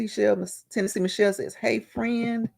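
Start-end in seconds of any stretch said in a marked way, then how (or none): none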